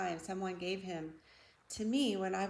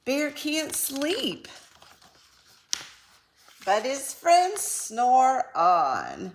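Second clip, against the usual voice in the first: slow, swelling storybook ending; slow final refrain; 4 semitones higher, 7 dB louder, slower than usual. A narrator's reading. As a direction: drag it out for the ending.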